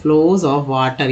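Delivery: very slow, word by word; slow model reading; slow reading demo. Shown as a demonstration. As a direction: read it out slowly for the class. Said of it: "तो इस तरह से दोस्तों आप पढ़ना सीख सकते हैं".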